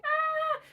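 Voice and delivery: high, held "ah"; mock wail. Stuck behind mountains with no roads, high mock wail. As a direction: let out a high cry of mock despair.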